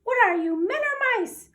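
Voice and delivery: high, loud mouse scolding; officer shaming his fleeing soldiers, squeaky. Acted. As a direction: taunt the troops in a tiny mouse voice. Big Cheese scolds troops.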